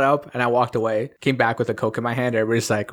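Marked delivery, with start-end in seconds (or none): none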